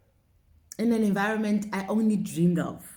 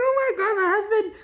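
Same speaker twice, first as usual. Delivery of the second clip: high, loud, a touch of crying in the voice; overwhelmed, half-crying on the phone; retold tearful cry; 13 semitones higher, 5 dB louder, faster than usual. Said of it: "I remember crying".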